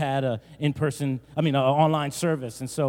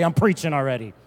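normal talking voice

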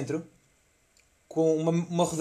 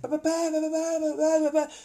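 very high mock-mom brush-off; high squeaky dismissive mom; mother dismissing; 12 semitones higher, slower than usual. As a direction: mimic mom waving him away, pitched way up.

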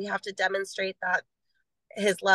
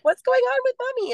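put-on high-pitched little-girl voice quoting her daughter; imitating daughter; 4 dB louder than usual. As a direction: squeak it like a startled toddler. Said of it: "then she's like".

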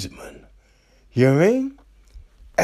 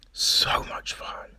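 slow hushed whisper; soft whispered joy; whispered delight; 7 dB quieter, slower than usual. Real, not acted. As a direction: breathe it out with relish.

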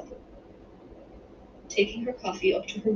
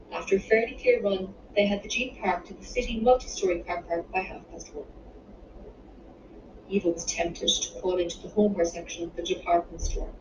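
plain reading voice

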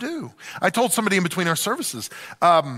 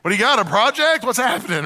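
curious neighbors; 5 semitones higher, 4 dB louder than usual. Retold or imitated. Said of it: "They're like".

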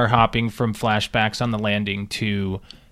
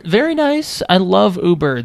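much higher, mock-delighted sing-song; mock gushing; 7 semitones higher than usual. To his cohosts, praising the Uber ride in a mock rave. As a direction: gush over it like an ad.

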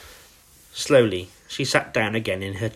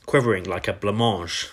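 ordinary delivery

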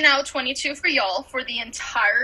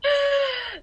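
mock gasp; 13 semitones higher than usual. Dramatic score reveal gasp.

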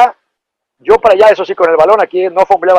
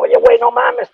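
high-pitched protest. Retold or imitated. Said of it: "Le digo".

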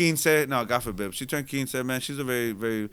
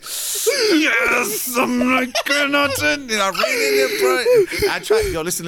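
high, loud and laughing; panicked, laughing storyteller; hysterical retelling; 5 dB louder, much slower than usual. Real, not acted. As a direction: tell it half-screaming with laughter.

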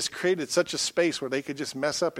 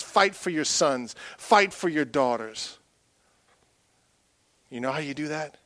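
usual speaking voice

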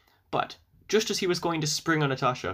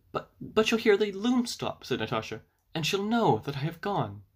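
put-on worried girl; 7 semitones higher than usual. A narrator's reading.

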